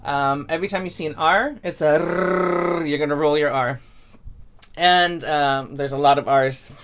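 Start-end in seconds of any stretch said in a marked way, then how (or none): 2.0-2.9 long trilled R; rolled R. Shown as a demonstration. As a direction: hold "R" as a long tongue-tip roll. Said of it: "all R's are rolled".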